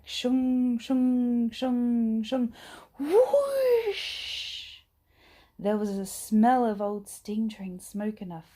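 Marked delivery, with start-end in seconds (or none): 0.0-2.5 loud, higher steam-engine shung; steam puffs. A narrator's reading. Train steam sound effect. 3.0-4.8 a very high, loud whoosh; train whoosh. A narrator's reading. Express train rushes out.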